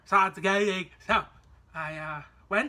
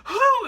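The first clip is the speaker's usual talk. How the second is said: high, loud exaggerated "whew"; relieved whew; 11 dB louder than usual. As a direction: let out a big, high whoop of relief. Retold relief once in the air.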